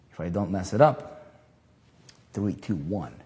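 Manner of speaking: ordinary delivery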